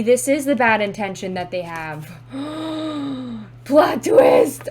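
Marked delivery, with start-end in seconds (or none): 2.2-3.6 drawn-out shocked gasp; long gasp. Real, not acted. Sudden realisation about the cards. 3.6-4.7 loud, high outburst; shocked exclamation. Real, not acted. Exclaims at a surprise realisation.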